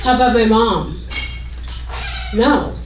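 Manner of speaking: usual speaking voice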